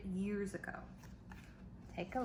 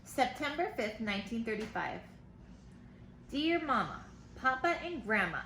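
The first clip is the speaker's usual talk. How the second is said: put-on lively read-aloud for kids; reading to children; 2 semitones higher than usual. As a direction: read the letter brightly to the class. Teacher reads letters aloud.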